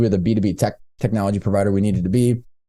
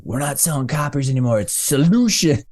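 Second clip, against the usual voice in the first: mock sales pitch; 5 semitones higher than usual. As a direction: parody the salesman's big reveal, voice lifted high. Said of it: "can't even say it without laughing".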